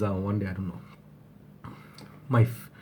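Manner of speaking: ordinary talk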